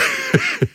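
short, high dry chuckle; brief wheezy snicker; dry laugh. A narrator's reading. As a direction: chuckle drily to yourself. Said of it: "Er lachte trocken".

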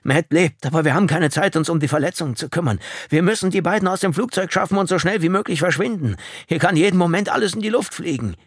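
high, loud, hurried orders; urgent alarm. A narrator's reading. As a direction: push him to move before it blows. Urgent plea to flee the wreck.

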